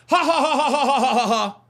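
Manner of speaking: loud, hammed-up jeering laugh; mocking ha-ha laugh. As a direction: laugh at him like a schoolyard bully. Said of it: "even if he wanted to".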